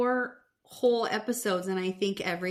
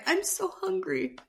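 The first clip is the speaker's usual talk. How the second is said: high, laughing whine; mock pitiful Mary; whiny Mary; 10 semitones higher than usual. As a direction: friend mimicking hungry Mary, high and whiny. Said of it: "And poor Mary".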